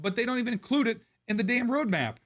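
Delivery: high, fast, voice straining; ranting until the voice gives; strained voice. Real, not acted. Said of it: "I'm losing my voice here".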